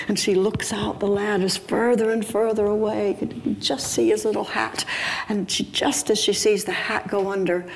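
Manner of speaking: suspenseful storytelling. A narrator's reading. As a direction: hold the suspense up high. Suspense as the hat sinks.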